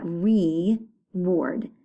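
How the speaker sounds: "reward" pulled apart as re, ward; broken up. Shown as a demonstration. Teacher asking pupils to hear the break, "reward" split in two. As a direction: hold re, then add ward separately in "reward". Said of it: "So it's broken up".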